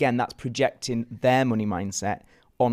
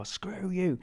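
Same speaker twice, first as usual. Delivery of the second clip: "Screw you" pitched up high and tossed off; higher line reading; 8 semitones higher, 5 dB quieter than usual. Shown as a demonstration. Actor varying the line, pitched up high. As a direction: lift the whole line up high. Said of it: "or you could go".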